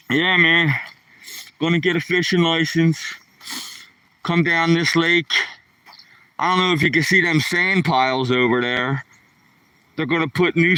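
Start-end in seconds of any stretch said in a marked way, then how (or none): none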